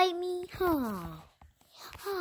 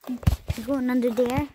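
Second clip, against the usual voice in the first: ordinary talk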